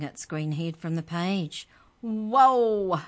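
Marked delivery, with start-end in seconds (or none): 2.0-3.0 loud, rising "Whoa"; startled shout. Acted. Trent shouts as the green head rises. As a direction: leap back in shock. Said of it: "Trent shouted".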